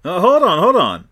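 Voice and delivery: high, loud hold on; excited interruption. Real, not acted. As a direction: cut in excitedly.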